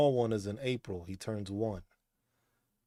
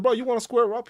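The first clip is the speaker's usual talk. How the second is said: higher, louder challenge to the cat; mock challenge; 10 semitones higher, 10 dB louder than usual. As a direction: call the cat out, loud and high. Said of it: "I was like".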